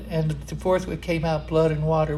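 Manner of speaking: normal talking voice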